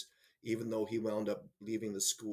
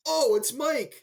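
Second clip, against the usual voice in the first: high, loud burst of recognition; oh, it's him, delighted and surprised; 10 dB louder, slower than usual. Retold or imitated. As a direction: let it burst out as it dawns on you. Sudden recognition of a former student.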